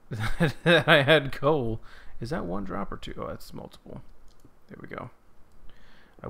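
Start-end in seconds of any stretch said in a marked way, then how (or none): 0.1-1.8 slow, loud words through laughter; laughing wish. Real, not acted. Wishing for coal, laughing it out.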